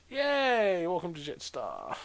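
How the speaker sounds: high sarcastic hooray, falling away; mock cheer. Traveller sums up being charged sixty bucks, mock cheer. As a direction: fake a high, loud celebration then drop.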